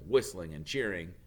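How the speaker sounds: ordinary delivery, nothing marked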